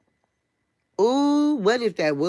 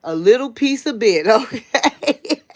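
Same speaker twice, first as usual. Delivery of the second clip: laughing; 5 semitones higher, 5 dB louder than usual. Real, not acted.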